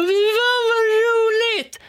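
very high, loud, drawn-out shriek; retold scream. Classmate flipping out across the classroom, mock hysterical scream. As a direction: flip out, screeching every stretched word. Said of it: "skriker ute".

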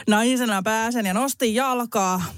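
ordinary talk, nothing marked

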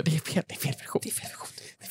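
panicked whisper. Acted. Acted, warning the others on live TV, whispering.

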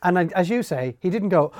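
ordinary delivery

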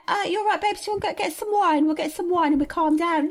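high mock Love Island accent, soothing a mate. Islander calming a mate with wine, Love Island accent. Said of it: "these accents".

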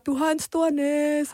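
high, drawn-out mock call; imitated taunting shout. Boys yelling at a passing TikToker, imitated taunting shout. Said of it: "så råber de bare".

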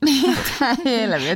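high, slow laughing disbelief; shocked. Real, not acted. Host reacting to the boss's insult, shocked and laughing. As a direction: gasp out the outrage, laughing. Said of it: "osaatko sinä siis aakkoset".

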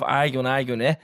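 ordinary delivery

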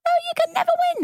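high-pitched catchphrase voice; high sing-song. Pretend. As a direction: squeak it out high. Echoing a catchphrase.

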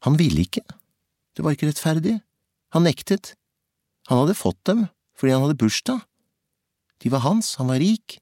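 høyere, trassig barnlig protest; indignert protest. A narrator's reading. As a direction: fortell det som en gutt som nekter å gi seg. Gutten nekter å gi fra seg pengene. (opprørt og trassig)

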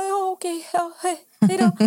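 high-pitched awkward goodbye in mimicry; imitated sheepish retreat. Rejected man says goodbye to the women, mock sheepish voice. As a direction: mimic a rebuffed man, dragging out the farewell.

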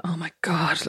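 breathless whispered groan. Retold or imitated. Stepmom stuck at home with the boys, whispered despair. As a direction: whisper it to yourself, worn out.

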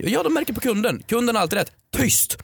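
high-pitched, bossy comeback; indignant retort. Acted. Customer who is always right silencing hairdresser, indignant. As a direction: cut them off with a high command.

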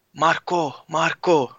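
mild mock yell to a teammate; retold shout. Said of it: "hör jag bara Nebo skrika till mig".

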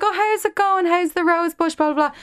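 high, sing-song small talk; chirpy neighbour voice. Retold or imitated. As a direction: mimic an over-friendly neighbour. Mocking chatty neighbour.

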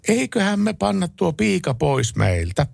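high, slow, coaxing housewife voice; conciliatory wife. Acted. Calmed-down wife proposing a deal, high and coaxing. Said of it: "sovinnollisemmalla äänellä".

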